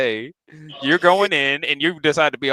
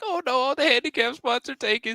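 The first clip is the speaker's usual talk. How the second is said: put-on old lady voice; 9 semitones higher, 5 dB quieter than usual.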